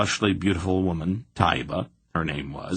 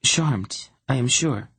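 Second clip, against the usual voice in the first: higher, haughty and cool; lofty superiority; 5 semitones higher than usual. A narrator's reading. Princess snubbing her rival, lofty and superior. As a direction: look down your nose at her. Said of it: "replied with a lofty superiority".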